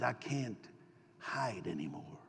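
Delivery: low, quiet, breaking under held-back tears; a touch of weeping. Retold or imitated. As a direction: Joseph can't hold it in any longer, softly. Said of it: "and he wept aloud".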